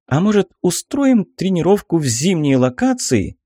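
eager. A narrator's reading. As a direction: propose it with sudden enthusiasm. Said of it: "загорелись глаза девушки".

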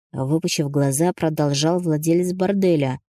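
ordinary delivery

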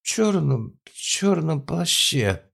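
put-on high, slow drunk insistence; drunk insisting.

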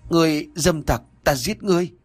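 cao giọng giận dữ, không gào; kêu lên đe dọa, giọng vút cao; hét giận dữ. A narrator's reading. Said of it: "giận dữ mà hét lên". (hét lên giận dữ)

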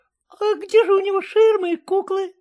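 высокий детский голосок; спроси звонко, как малыш; маленький мальчик. A narrator's reading. Мальчик спрашивает дальше. (детский голосок, удивлённо спрашивает)

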